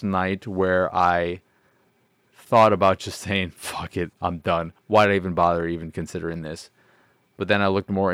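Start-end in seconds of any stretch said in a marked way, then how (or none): none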